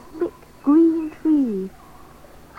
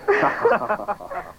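laughing. A narrator's reading. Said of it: "They laughed then".

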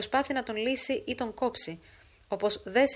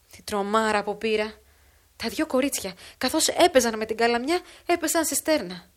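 put-on somewhat louder, busy storytelling old woman; old woman's lively false account; 3 semitones higher, 6 dB louder than usual. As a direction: spin the lie briskly as a shaken neighbour.

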